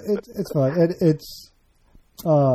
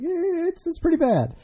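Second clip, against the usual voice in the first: high wince. Real, not acted.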